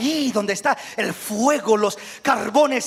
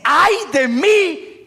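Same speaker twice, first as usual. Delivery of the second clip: slow, high, louder wail; anguished cry; 9 semitones higher, 5 dB louder, much slower than usual. Acted. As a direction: cry out in despair. Isaías clama ante Dios. (grito lento y angustiado, más agudo)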